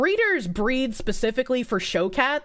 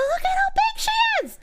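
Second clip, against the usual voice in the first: delighted squeal. Real, not acted. To chat over a giant cat photo, high squeal.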